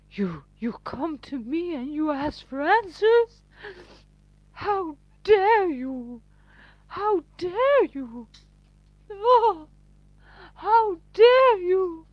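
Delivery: high, loud theatrical indignation; pompous mock outrage. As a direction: build to a hammed-up wail of affront. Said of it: "They do sound so pompous".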